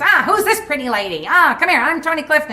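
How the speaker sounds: high, loud lounge-singer growl; brash, pushy showbiz sleaze; hammed-up Tony Clifton lounge act. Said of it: "talking like this".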